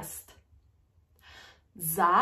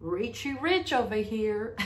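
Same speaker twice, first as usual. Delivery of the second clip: high mock-impressed okay; playful sarcastic jab; teasing retort; 4 semitones higher than usual. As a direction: tease the big spender. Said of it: "I'm like".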